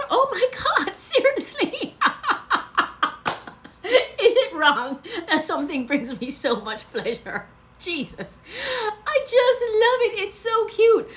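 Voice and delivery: high, loud squealing gush with laughter; gushing delight. Real, not acted. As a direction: squeal over something adorable. Gushing over her souvenir hat.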